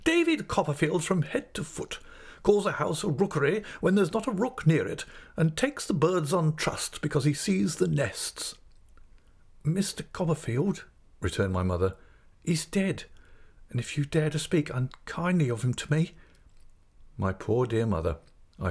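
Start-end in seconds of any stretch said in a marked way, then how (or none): 0.0-8.5 high, emphatic scolding; indignant aunt, pitched up; exasperated crying out. Acted. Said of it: "cried Miss Betsy". 9.6-10.8 higher-pitched, hesitant character voice; the mother's voice, pitched up; timid young mother figure. Acted. 12.4-16.1 higher-pitched, wounded reply; hurt young widow's voice; offended mother figure. Acted.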